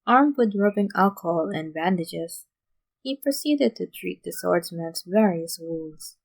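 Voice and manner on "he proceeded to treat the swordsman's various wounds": ordinary delivery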